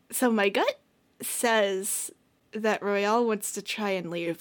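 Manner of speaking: usual speaking voice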